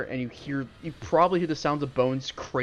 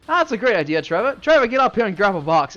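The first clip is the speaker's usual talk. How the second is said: bossy bandit; 7 semitones higher, 10 dB louder than usual. Acted. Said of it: "He goes".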